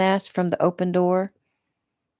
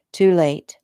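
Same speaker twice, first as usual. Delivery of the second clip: ordinary delivery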